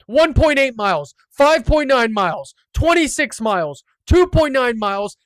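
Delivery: loud victory gloat; triumphant bragging. Real, not acted. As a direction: crow about your scores, punching each one. Brags about his scores.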